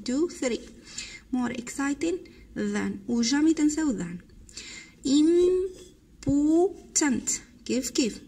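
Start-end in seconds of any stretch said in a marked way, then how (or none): 5.1-7.3 Important stretched very slowly, a bit louder; slow drilled word. Shown as a demonstration. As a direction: drag out Important for the class to copy. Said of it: "drilled word for pupils". (نطق بطيء وممدود للتلاميذ)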